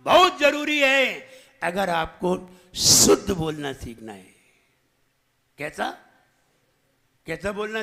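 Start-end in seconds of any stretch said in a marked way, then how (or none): 2.8-4.3 "शुद्ध" with a loud, long hissing sh; whistled sibilant. Shown as a demonstration. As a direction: hit the opening sh of "शुद्ध" hard, then let the pitch fall. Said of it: "अगर सीटी नहीं आती है".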